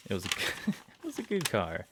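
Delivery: quoting it through real laughter; laughing. Real, not acted. Gamer recalling the car joke, laughing. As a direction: chuckle while repeating the line.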